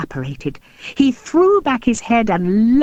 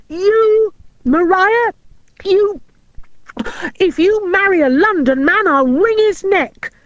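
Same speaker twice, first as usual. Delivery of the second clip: furious explosion; 11 semitones higher than usual. A narrator's reading. Jealous Robin threatening the London man, furious. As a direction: roar the threat at her. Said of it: "he exploded".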